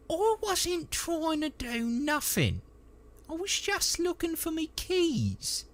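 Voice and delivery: very high, protesting dialect voice; defensive trooper. Acted. Trooper denying he tried to steal, high-pitched protest. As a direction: whine an excuse in a high dialect voice.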